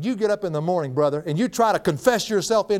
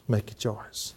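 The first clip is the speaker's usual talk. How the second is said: softer repeat; 5 semitones lower, 8 dB quieter, slower than usual. Shown as a demonstration. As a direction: Make said softer, falling.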